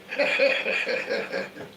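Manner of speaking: high, real burst of laughter; amused laugh. Real, not acted. Laughing at her boss teasing her sick phone voice, amused laugh. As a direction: laugh out at the memory.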